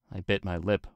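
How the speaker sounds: plain reading voice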